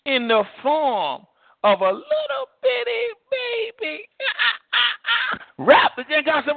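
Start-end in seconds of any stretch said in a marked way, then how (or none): 0.1-4.1 high, slow, cracking as if near tears; God marvelling at a little bitty baby; tender God voice. Acted. 4.1-5.5 high delighted laugh. Real, not acted.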